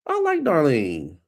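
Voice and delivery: ordinary talk, nothing marked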